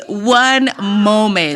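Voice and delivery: very slow, stretched phone reply; drawn-out reply. Retold or imitated. Woman on phone asks caller to wait, drawn out. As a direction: stretch each word for suspense. Quotes the woman again for suspense.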